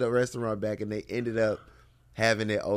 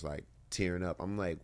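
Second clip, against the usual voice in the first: ordinary delivery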